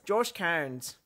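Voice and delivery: playfully put-on Irish accent on the name; hammed-up Irish-accented name; mock Irish accent. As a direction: give "Cairns" a joking Irish sound. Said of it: "you just do it with an accent".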